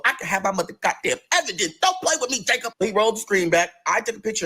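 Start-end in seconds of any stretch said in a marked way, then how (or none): 1.8-2.7 pitched way up, sassy warning; mock warning. Narrator to coworker, high sassy warning. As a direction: snap at him high and sharp.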